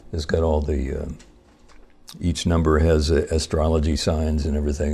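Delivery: usual speaking voice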